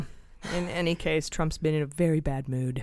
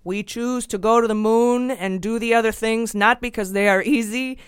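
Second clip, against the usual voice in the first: put-on Boston Kennedy accent, loud and high; JFK impression; 5 semitones higher, 7 dB louder than usual. Host quoting the moon speech in a Boston Kennedy accent. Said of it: "I gotta get my accent right".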